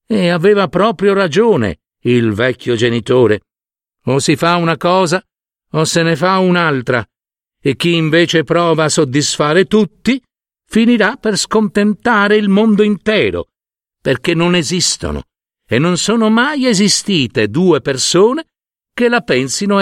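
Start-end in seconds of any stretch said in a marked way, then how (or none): none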